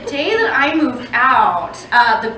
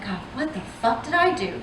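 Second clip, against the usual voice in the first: much softer, deflated what the fuck did I do; quiet despair; 10 dB quieter than usual. Retold or imitated. Comic quietly repeating her panicked question, much softer. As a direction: mutter it under your breath, defeated. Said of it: "So I'm like".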